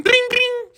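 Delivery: very high ringing "bing, bing"; bicycle bell imitation. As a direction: squeal out two little bell dings. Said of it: "he has a little bell on his bike".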